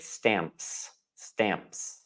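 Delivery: "Stamps" said softly with a faint P. Shown as a demonstration. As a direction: say "Stamps" carefully, letting the P just be heard. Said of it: "you can hear it just a little".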